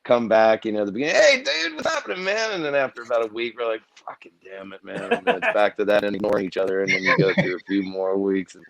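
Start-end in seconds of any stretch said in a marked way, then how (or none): 1.2-2.5 high, drawn-up buddy greeting; mock-cheery reunion hello; friendly greeting. Acting out friendly greeting after a break. 4.1-5.1 low, very soft grumble; acted-out weary irritation, barely voiced; fed-up muttering. Fed up with each other a week later.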